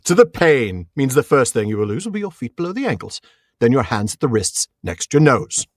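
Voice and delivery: deliberate, theatrical villain-taunting hero; Cary Elwes. Acted. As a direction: deliver a measured, menacing threat. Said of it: "a masterful Cary Elwes impression".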